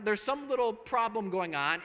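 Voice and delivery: normal talking voice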